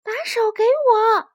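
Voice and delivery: high, slow, drawn-out call; little hedgehog's high rescue shout; loud shout. A narrator's reading. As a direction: shout urgently to a friend in the water. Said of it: "小刺猬大声喊道".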